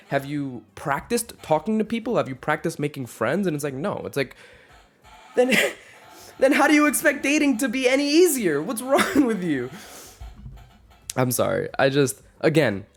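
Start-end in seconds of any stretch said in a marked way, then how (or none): 5.4-9.7 very high, loud exasperated yell; angry outburst. Acted. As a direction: explode in hammed-up frustration. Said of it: "internalized anger at myself".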